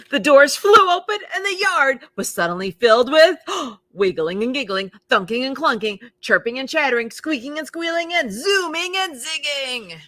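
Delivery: loud, high, bursting read; excited. A narrator's reading. Narrator telling the recess burst, loud and high. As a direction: let the recess chaos explode.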